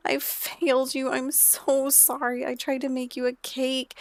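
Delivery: mock-bawling, high-pitched apology; Eve. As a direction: act out a sister wailing her regret. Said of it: "bawling her eyes out because she feels terrible".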